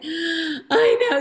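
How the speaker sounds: high, crying-tinged reply; a teary little admission; tearful I know. Retold or imitated. Wife answering her husband about the test, half crying.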